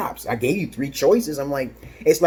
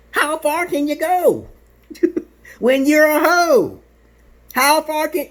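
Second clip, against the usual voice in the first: very high, drawn-out taunt; slow, loud mocking cry; 14 semitones higher, 5 dB louder, slower than usual. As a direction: stretch it out high and jeering, chuckling midway. Taunting threat at absent wife.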